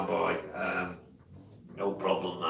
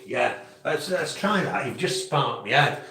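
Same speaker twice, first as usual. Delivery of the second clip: usual speaking voice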